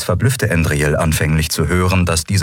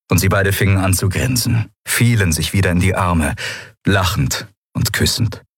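usual speaking voice

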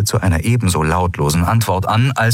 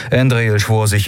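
ordinary delivery

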